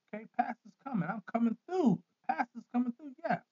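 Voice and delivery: high, cheery voice rushing to help; mock eager pastor. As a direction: play the pastor who comes running at night. Acting out a late-night caller.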